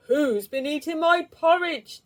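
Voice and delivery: the big bear demanding from the kitchen; loud gruff voice. A narrator's reading. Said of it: "a loud, gruff voice".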